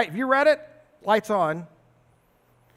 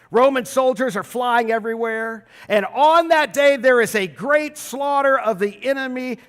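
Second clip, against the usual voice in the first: ordinary talk, nothing marked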